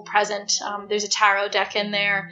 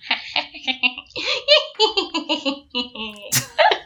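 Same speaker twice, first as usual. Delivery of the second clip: cackling witch laugh; 4 semitones higher than usual. Acted. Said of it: "It's my best witch laugh".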